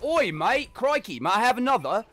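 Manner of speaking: loud, very high British caricature veering Australian; mock British accent. Canadian showing how British people sound to him, loud mock British accent. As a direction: squawk it in a bad British accent. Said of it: "Love the British accent".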